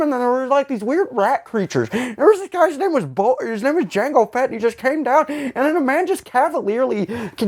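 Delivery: high, giddy mock summary of the plot; mock excited retelling. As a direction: gush like a kid, sarcastically. Mocking retelling of prequel plot.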